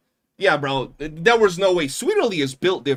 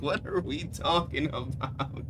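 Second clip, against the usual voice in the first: normal talking voice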